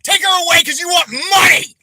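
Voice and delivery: high, loud furious yell; real fury boiling over; enraged shout. Real, not acted. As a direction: scream the accusation. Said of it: "I'm real fucking heated tonight".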